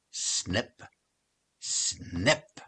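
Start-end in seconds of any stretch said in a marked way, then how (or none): none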